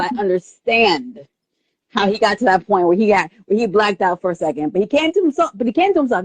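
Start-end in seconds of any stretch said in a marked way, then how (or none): none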